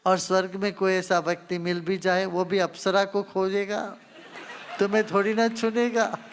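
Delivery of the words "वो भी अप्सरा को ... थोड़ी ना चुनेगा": louder, rising through laughter; laughing tease. Real, not acted. Guru joking to a choosy woman seeking a husband, laughing tease. Teasing the unmarried questioner, laughter.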